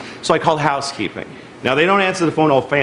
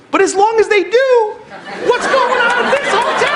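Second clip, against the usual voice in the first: outraged rant; 13 semitones higher than usual. Real, not acted. Comic ranting to the audience, high-pitched and laughing. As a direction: rant up at the crowd. Ranting about hotel service.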